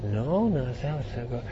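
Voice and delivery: very soft imitation of someone pondering; thinking to himself. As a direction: talk under your breath as if puzzling it out. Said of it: "I can hear him".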